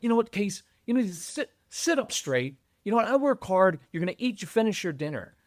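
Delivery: hammed-up strict father at dinner; scolding dad.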